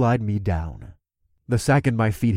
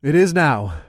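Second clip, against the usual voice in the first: announcing; 4 dB louder than usual. Acted. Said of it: "I announced".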